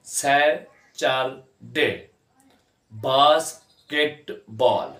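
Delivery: सैटरडे and बास्केटबॉल very slowly, three separate beats each; drawn-out, broken-up सैटरडे, बास्केटबॉल; syllable by syllable. Shown as a demonstration. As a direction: leave a gap after every syllable of सैटरडे and बास्केटबॉल. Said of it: "तीन syllable हैं".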